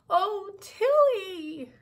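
drawn-out high, loud wail. A narrator's reading. Miss B tearful at Tilly's words, wailing. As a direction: wail the name slowly and high. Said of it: "she wailed".

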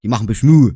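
Beschmu said with a falling tune; dialect word Beschmu. Shown as a demonstration. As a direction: Saxon Beschmu landed firmly. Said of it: "Alles Beschmu".

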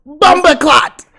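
sudden high, very loud playful yell; mock Jamaican shout. Host teases the arriving Jamaican guest, mock shout. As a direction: blurt it out as a joke at full volume.

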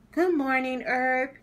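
lightly voiced girl saying good morning; Muriel greeting. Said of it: "said Muriel".

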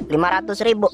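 ordinary talk, nothing marked

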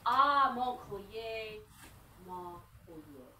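slow, drawn-out actor's delivery; hammy stage actor. Shown as a demonstration. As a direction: speak it like a stage actor, savouring it. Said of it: "So just speaking like an actor".

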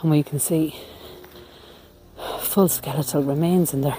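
breathless after climbing to peep in; winded, catching breath between phrases. Real, not acted. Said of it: "I'm kind of out of breath now".

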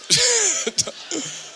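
a real high burst of laughter. Real, not acted. Comic cracking up at his own punchline, high laugh. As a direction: laugh at your own joke.

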